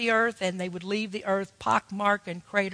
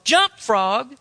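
louder, higher retold yell at the frog; mock yell; 4 semitones higher, 6 dB louder than usual. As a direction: bark the order at a stubborn frog, hammed up. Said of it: "he just yelled at the frog".

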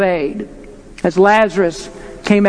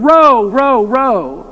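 shipmaster's call; 2 semitones higher, 3 dB louder, much slower than usual. Retold or imitated. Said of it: "like a shipmaster as he calls out to his oarsmen".

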